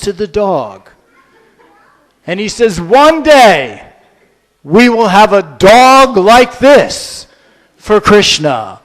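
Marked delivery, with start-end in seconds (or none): 2.8-8.8 louder, slow and proclaiming; solemn declaration. Acted. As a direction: announce it like a prophecy. Prabhupada points at the dog.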